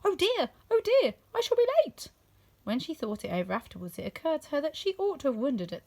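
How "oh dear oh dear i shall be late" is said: high, loud and flustered. A narrator's reading. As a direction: squeak it out like a panicking rabbit. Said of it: "hear the rabbit say to itself".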